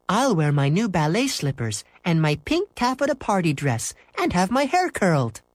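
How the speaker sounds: high-pitched, pleased-with-herself boast; proud. Acted. Mary Jane describing her party dress, proud. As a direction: show off the costume with a smile. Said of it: "Mary Jane smiled proudly".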